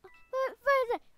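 high, loud, fast jolt; startled. Real, not acted. Player startled by his own pet, fast high alarm. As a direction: jump at a noise in the dark.

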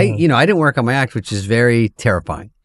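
ordinary talk

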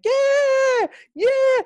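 very high, loud whooping yeah; imitated ecstatic cheer; elated shouting. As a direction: yell it like someone running in triumph. Healed man running, celebrating.

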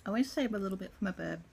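talking with food in mouth; chewing while talking. Real, not acted. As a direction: mouth partly full. Seen 0: Mouth closed and working between bites; ate just before speaking.

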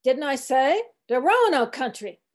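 put-on mildly sharp old-cook retort; sharp cry. A narrator's reading. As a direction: answer back sharply in dialect. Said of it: "he cried sharply".

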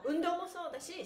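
usual speaking voice

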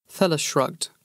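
ordinary delivery, nothing marked

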